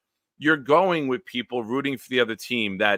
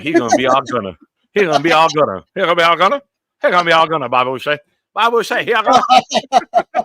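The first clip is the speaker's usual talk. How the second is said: loud, high hammed-up Southern twang; a hammed-up Southern accent, rising to a squeal; mock Southern drawl; 6 semitones higher, 9 dB louder, faster than usual. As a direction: exaggerate his Southern accent, louder each time. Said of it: "You went".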